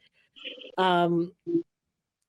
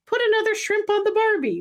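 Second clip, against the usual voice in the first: high, put-on voice without a real accent; failed Australian attempt, pitched way up; imitated wife's non-accent; 12 semitones higher than usual. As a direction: quote the wife in a much higher voice. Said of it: "but not really with an accent".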